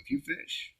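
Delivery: unvoiced high whisper. Real, not acted. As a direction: breathe it out in a whisper. Suddenly breathy aside.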